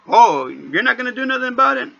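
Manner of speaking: indignant challenge to the careless dad; indignant retort. Retold or imitated. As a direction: throw it back at him.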